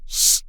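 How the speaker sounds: loud, sharp shushing sound; urgent shush. A narrator's reading. Friend hushing sobbing girl, urgent shush. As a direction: shush them firmly. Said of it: "You're gonna wake everybody!".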